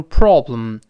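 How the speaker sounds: "problem" with a rounded British o; British pronunciation. Shown as a demonstration. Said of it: "英国人都用problem".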